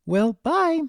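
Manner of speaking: high, called-out farewell; shouted goodbye. Acted. Foals shouting bye while rushing off, high. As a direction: call it as you run off. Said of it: "the two foals shouted".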